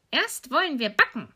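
high, lilting witch voice; scheming old witch. Acted. Old witch planning to bake, high scheming voice. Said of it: "sagte die Alte".

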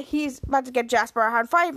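usual speaking voice

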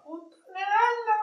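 very high, far-off shrieking call; calling from far away. Acted. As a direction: shriek it up from the cellar. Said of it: "dai sotterranei a fare".

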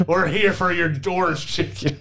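high Southern voice, laughing; Southern conspiracy theorist. Acted. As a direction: paranoid Southern warning. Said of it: "conspiracy theorist voice is a Southern accent".

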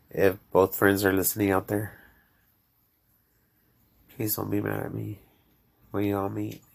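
ordinary talk, nothing marked

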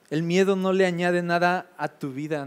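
normal talking voice

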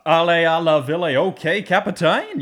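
high, loud, slow, hammed-up bad French accent in French; terrible French accent. Host mocking the American soldier's terrible French accent. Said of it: "worst French accents I've ever heard".

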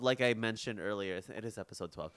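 usual speaking voice

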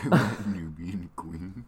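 slow drawn-out seductive line; mock seductive voice. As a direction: say it slow and sultry. Echoing in sexy voice.

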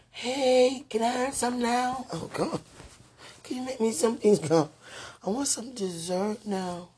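high, loud pleading whine; begging for dessert in a high whiny voice; childlike begging. Acted. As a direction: beg like a kid for dessert.

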